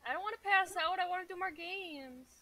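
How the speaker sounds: high-pitched, whiny plea; playful protest. Pretend. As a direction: protest like a kid sent home early. Player protests being knocked out of the games.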